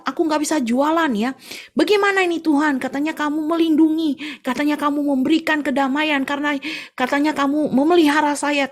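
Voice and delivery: high, loud complaining to God; protesting to God. Acted. Tofu seller protesting to God, high complaining voice. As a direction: whine your grievance upward. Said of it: "dia protes sama Tuhan".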